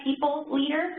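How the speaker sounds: PEOPLE and LEADER slow and separate; said slowly. Real, not acted. Presenter taking care over a job title, slowly. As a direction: say "people leader" slowly, keeping the two words apart. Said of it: "I have to say that slowly".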